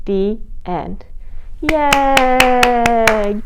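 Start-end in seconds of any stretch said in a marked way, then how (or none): 1.6-3.4 cheer. Real, not acted. Cheer after the story.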